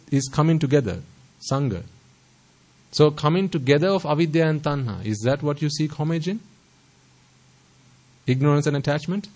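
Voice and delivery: ordinary delivery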